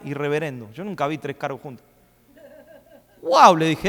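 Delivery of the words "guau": mock amazement. Mock amazement at many titles.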